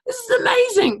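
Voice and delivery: very high, thrilled exclaim; very high excited gush; gushing delight. Retold or imitated. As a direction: squeal with enthusiasm. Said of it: "I'm like".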